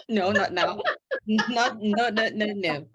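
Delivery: laughing no, no, no; laughing denial. Real, not acted. Host laughs off the baby joke, laughing protest. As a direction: wave the idea off through laughter.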